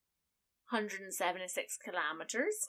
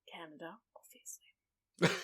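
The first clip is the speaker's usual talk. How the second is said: whispered aside; 5 semitones lower, 5 dB quieter than usual. Real, not acted. Dry aside to co-host.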